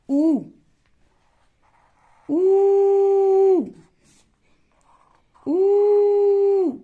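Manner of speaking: ऊ held long, high and loud; long drawn ऊ. Shown as a demonstration. Teacher to pupils, stretching the long ऊ. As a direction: pull the ऊ out long. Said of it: "मैं क्यों खींच रही हूं".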